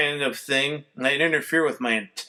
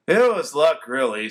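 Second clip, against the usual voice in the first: usual speaking voice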